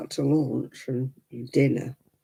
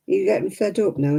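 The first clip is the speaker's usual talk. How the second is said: ordinary talk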